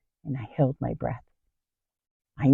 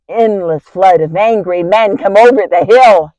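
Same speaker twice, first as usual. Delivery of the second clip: louder, higher, mounting alarm; acted alarm; 5 semitones higher, 8 dB louder than usual. Wife sees hundreds of angry men coming over the hill, alarmed. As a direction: build to awed panic at the sheer numbers.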